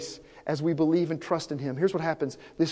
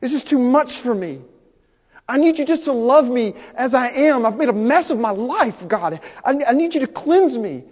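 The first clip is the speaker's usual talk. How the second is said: pleading prayer; 6 semitones higher, 5 dB louder than usual. Acted. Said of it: "come before him and say".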